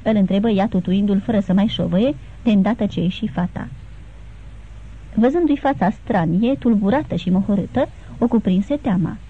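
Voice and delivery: plain reading voice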